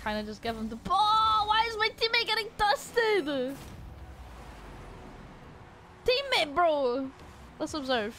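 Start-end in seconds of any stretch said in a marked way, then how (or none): 0.8-7.1 exasperated yell. Real, not acted. Teammate getting beaten.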